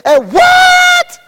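sudden high shouted question; rhetorical shout. Acted. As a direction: fling the question at the crowd. Preacher's rhetorical climax.

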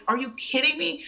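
high-pitched retold disbelief; incredulous. New mother to hospital staff, incredulous. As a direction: recount your disbelief at the very suggestion. Reacting to baby visit suggestion.

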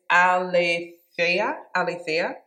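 "aletheia" said slowly as a-le-THEI-a; careful pronunciation. Shown as a demonstration. Teacher carefully pronouncing the Greek "aletheia" for the class. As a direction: sound out "aletheia" syllable by syllable, rising. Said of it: "I'm gonna try and pronounce it".

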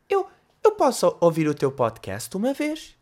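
slow put-on voice of a timid kid; kid Jake. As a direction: ask shyly like a little boy. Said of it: "Não, Jake, o que é que estás aqui a fazer". (Jake, miúdo tímido, voz fingida)

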